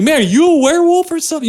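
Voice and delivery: high-pitched, quick, baffled mock question; incredulous mock outburst. Movie reviewer acting out his reaction to the screaming lead, incredulous. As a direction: act out a bewildered viewer's outburst.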